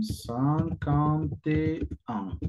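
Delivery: "cinquante et un" muy lento y separado; very slow demo. Shown as a demonstration. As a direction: arrastra cada palabra de cinquante et un. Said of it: "La pronunciación". (despacísimo, como modelo)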